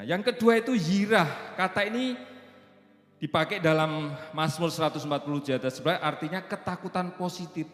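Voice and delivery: high, loud preacher's voice, slowing on the key line; preaching at full force. Acted. Pastor preaching to the congregation about fearing God, high and loud. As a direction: drive the point home from the pulpit.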